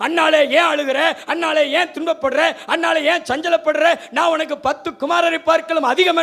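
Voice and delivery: high, loud sing-song mock of his comfort; preacher mocking the selfish husband's consolation; mocking Elkanah. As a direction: parrot his empty words scornfully. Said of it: "என்று சொல்லுகிறான்".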